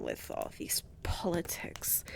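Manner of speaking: hushed aside; confiding whisper. Acted. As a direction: drop to a whisper. Aside about her father.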